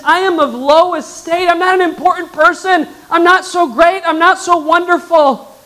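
a hint of Mary, small and unworthy; voicing Mary. Preacher voicing Mary, mildly high and humble.

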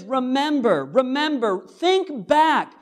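high, loud, insistent repetition; urgent plea. Retold or imitated. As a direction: call them back, pressing hard. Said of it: "He says".

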